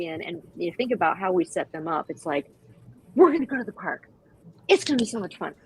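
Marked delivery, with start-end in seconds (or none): 3.1-5.5 high, bubbly voice pumping up the dog; hyped-up owner. Retold or imitated. Owner hyping the dog for the park, high and bubbly. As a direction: sell the park trip to the dog like a treat.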